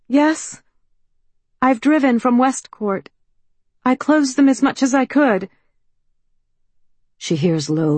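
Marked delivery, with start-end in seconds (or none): 0.1-5.5 put-on higher, louder, pleading young woman; anxious young woman. A narrator's reading. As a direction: call out, nervous and hopeful. Mallory answering.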